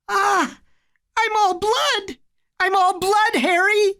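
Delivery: put-on very high little-boy wail; high, frightened squeal; panicked child. A narrator's reading. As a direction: cry out in rising alarm, voice way up. Bleeding boy panics at blood.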